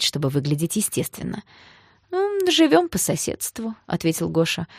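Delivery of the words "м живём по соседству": higher-pitched, slowed coy reply; soft, slightly mincing. A narrator's reading. Гоша answers a question about Танька, mincing a little. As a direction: answer softly with a touch of camp. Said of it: "мягкий и чуточку жеманный".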